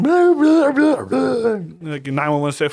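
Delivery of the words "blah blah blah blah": high, loud panicky gibberish; high frantic mock babble. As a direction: babble like a hysterical caller. Mocking the frantic caller.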